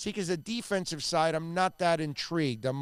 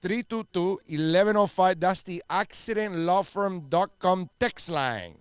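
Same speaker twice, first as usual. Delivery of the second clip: higher, louder host patter in a put-on Miami accent; a playful Miami accent as the host opens hour four; 5 semitones higher, 3 dB louder than usual. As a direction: lay on a Miami accent, like a hyped radio host. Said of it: "You're just doing an accent".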